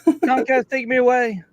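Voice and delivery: high, swooning mock plea; melodramatic plea. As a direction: plead like a swooning heroine.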